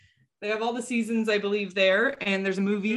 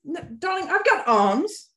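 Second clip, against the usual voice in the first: Edina quote; 9 semitones higher, 4 dB louder than usual. Retold or imitated. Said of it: "I love to occasionally use the".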